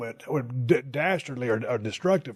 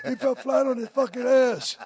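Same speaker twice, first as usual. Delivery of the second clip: higher, louder mock Manchester accent yelling; 9 semitones higher, 4 dB louder than usual. Host imitating Davey Boy's Manchester yell on air. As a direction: ham up a Manchester announcer's outburst. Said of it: "Davey Boy with that Manchester accent".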